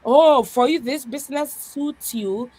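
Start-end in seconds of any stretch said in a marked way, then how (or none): none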